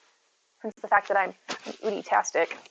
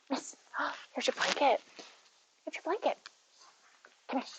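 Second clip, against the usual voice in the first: soft coaxing; 4 semitones higher, 11 dB quieter than usual. Real, not acted. Coaxing cat, softly.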